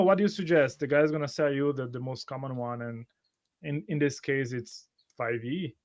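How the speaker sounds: ordinary delivery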